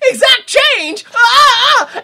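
high, loud hammed-up hero yell; mock yell. Hero at the toll booth, high loud mock yell. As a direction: bark it like a cartoon action hero.

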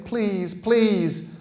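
high, loud pleading cry; frantic loud plea; desperate shouting. A narrator's reading. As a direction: beg for your life at full voice. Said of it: "He was shouting wildly, desperately".

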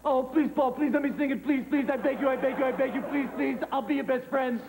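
rapid-fire repeated begging; wheedling, insistent plea; pleading. Acted. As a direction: beg like an eager child. Begs to sing the song.

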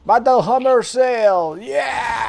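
high, slow, drawn-out war cry; battle cry. Pretend. Player hollering a dwarf clan cry in battle, drawn-out war cry. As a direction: stretch it into a long high cry.

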